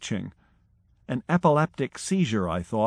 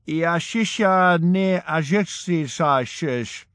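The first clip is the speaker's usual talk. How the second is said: garbled reply; 6 semitones higher, 3 dB louder, slower than usual. Retold or imitated. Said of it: "he replied".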